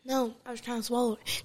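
talking while still chewing; mouth full. Real, not acted. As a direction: speak around a mouthful of food. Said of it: "is it hot or is it nasty?".

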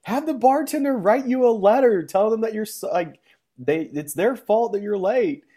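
a guest advising grandpa about a note, high mock voice.

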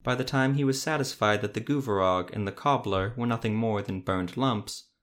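usual speaking voice

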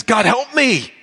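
high, loud plea for help; crying out to God. Retold or imitated. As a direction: call up to God for rescue. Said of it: "he cries vertically".

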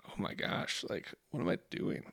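whispered, dismayed inner thought; hushed realisation. Retold or imitated. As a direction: breathe it out as a horrified self-question. Remembering his own guilty realisation.

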